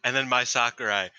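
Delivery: ordinary talk, nothing marked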